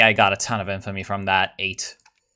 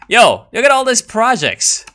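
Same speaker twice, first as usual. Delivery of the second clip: much higher and louder, excited outburst; 12 semitones higher, 8 dB louder than usual. Real, not acted. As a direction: burst out at the sight of projects. Sees list of projects.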